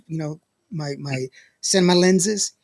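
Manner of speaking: ordinary talk, nothing marked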